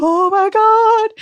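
high, loud squeal of disbelief; high, slow thrilled gasp of oh my God; excited oh my God. Retold or imitated. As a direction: squeal it, stunned with joy. Reacting to red tail hawk.

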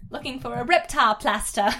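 put-on loud kid line, plaster said British; British accent on plaster. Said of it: "say plaster in a British accent".

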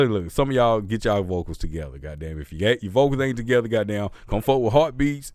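normal talking voice

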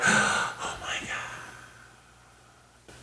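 slow, very soft stunned whisper; hushed, breath-only amazement; awed whisper. Acted. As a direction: whisper it slowly in mock awe. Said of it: "everyone's like".

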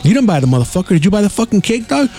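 high-pitched mock snap back; retold comeback. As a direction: fire back at him, voice pushed way up. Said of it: "I said".

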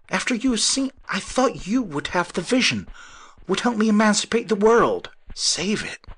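high, pleading, dropping away at the end; voice failing. A narrator's reading. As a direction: plead until the voice gives out. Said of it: "His voice failed".

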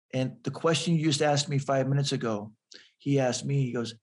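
ordinary delivery

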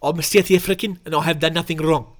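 put-on South African voice; South African accent. Podcast host testing a voice for the victim's quote, South African accent. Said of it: "Let's do a South African voice".

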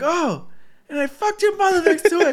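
high, loud mocking taunt. Friend imitates the video guy taunting, high and loud. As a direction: gloat it like a jeering guy.

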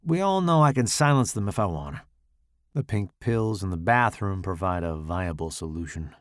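ordinary delivery

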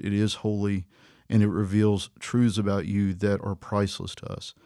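soft, low prayer voice. Acted. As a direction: pray gently to the Father.

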